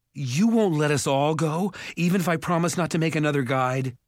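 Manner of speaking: high, urgent plea; desperate bargaining. A narrator's reading. Jared bargaining with the ogre, desperate. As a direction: plead urgently, speeding up.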